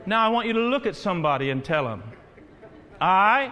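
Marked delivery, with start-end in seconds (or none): 3.0-3.5 firm call-out, one word alone; leading a repeat-after-me. Shown as a demonstration. Pastor leading everybody word by word, firm call-out. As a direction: call it out for a crowd to echo.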